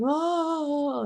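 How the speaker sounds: high wail, mimicking a child; retold high-pitched crying wail; imitated bawling. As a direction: bawl like an upset little girl. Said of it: "She's bawling".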